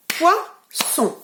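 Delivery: POISSON split into two high beats; découpage en syllabes. Shown as a demonstration. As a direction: say "Poisson" syllable by syllable, pausing between. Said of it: "nous allons claquer les syllabes ensemble". (« Poisson » scandé syllabe par syllabe)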